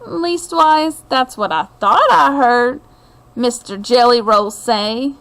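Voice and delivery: ordinary delivery, nothing marked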